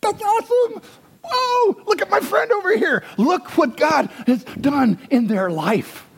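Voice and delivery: very high, excited cheer; high gushing praise for a friend; celebrating a friend. Retold or imitated. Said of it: "I love coming alongside and say".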